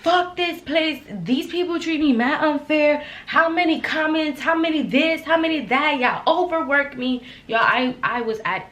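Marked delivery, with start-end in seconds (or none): 0.0-7.2 retold rant. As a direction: vent about the job walking out. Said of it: "I'm like".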